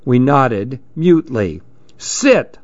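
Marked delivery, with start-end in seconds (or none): 2.0-2.6 sharp, much higher one-word command; ordering. A narrator's reading. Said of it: "he ordered".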